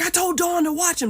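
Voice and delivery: high, loud frantic protest; panicked shout. Retold or imitated. Panicked father defends himself to frantic mother, loud. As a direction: blurt it out in alarm.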